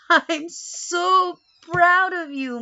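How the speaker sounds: slow, loud, high proud praise; mother praising daughter after race, proud and loud. Acted. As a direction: beam with pride, drawn out. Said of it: "Mommy said".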